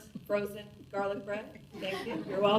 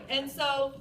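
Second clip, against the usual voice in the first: ordinary talk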